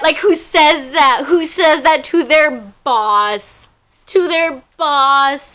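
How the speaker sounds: slow, emphatic outrage; appalled repeat; indignant disbelief. Real, not acted. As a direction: stress who and boss in disbelief. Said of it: "disbelief ranting".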